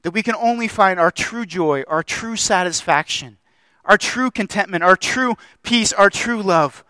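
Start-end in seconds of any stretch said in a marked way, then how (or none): none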